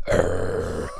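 deep low growl imitating a pit bull; imitated dog growl, low. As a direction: snarl like an attack dog. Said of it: "his dogs on cue went".

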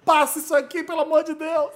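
high pleading cry, passa isso aqui; desperate plea. Pretend. Host imagining running to editing, desperate high plea. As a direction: beg the editors frantically. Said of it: "saído correndo na ilha de edição".

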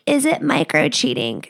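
exaggerated mimicry of a video creator; put-on mocking voice. Mimicking a TikTok woman asking about micro cheating, mocking. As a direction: put on a silly voice for the question. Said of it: "that's not how she said it".

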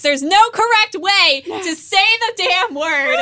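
high, loud exasperated rant; mock outrage. Real, not acted. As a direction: fake fury, almost yelling. Heated rant about pronunciation.

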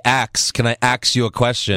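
"Axe" pronounced like the chopping tool; "ask" as "axe". Retold or imitated. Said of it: "I would always say".

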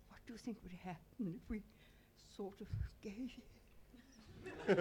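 weary, fading into a whisper; half give up as you ask it; exhausted. Retold or imitated. Asking her friend Barbara whether to give in: exhausted, trailing into a whisper. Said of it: "in a sort of exhausted way".

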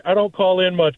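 usual speaking voice

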